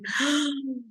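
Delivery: sharp happy gasp of joy; delighted gasp. Retold or imitated. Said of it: "clients ... going".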